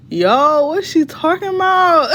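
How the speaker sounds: high-pitched, incredulous retelling; baffled outburst. Retold or imitated. Daughter puzzling over mother's texts, incredulous. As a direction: climb high in exaggerated disbelief.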